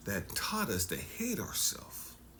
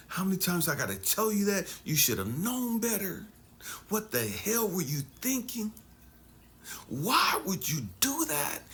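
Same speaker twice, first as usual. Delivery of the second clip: high, fast exasperated scolding, slowing on the last question; lecturing parent; 9 semitones higher than usual. Retold or imitated. Parent scolding child for misbehaving, high fast exasperation. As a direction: berate the kid, then drag out the final why. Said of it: "They always had something to say".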